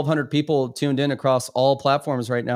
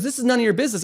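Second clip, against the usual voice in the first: higher imitation of a woman snapping back; retold defensive retort; 7 semitones higher, much faster than usual. Woman at the gym rebuffing a man, high retold retort. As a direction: brush them off, pitched well up.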